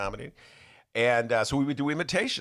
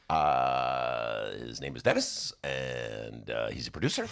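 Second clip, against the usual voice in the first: much lower, put-on Obama voice; Barack Obama imitation; 11 semitones lower, slower than usual. Host slips into his Barack Obama imitation, lower. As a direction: drop into a slow Obama drawl. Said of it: "my Barack Obama imitation".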